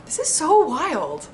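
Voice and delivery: high, loud amazement; thrilled outburst; excited. Real, not acted. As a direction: exclaim in disbelief. Reacting to old letter.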